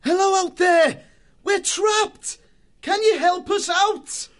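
very high, loud shout, slowing at the end; shouting for help. A narrator's reading. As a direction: yell up through the hole for rescue. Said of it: "put his mouth close to the hole and shouted".

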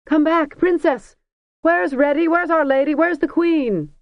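high, loud crowd calls; wild calling. A narrator's reading. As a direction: call out after her wildly. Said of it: "called more wildly than ever".

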